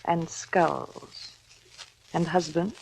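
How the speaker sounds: wife murmuring a grim list to her husband; hushed sinister murmur. Acted. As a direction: murmur it like a dark plot.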